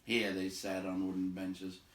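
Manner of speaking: plain reading voice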